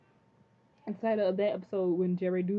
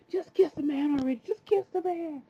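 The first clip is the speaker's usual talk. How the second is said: high, loud mock yell; impatient mom; 9 semitones higher, 6 dB louder than usual. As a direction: imitate a mom hollering at the TV. Imitating mom yelling at the show.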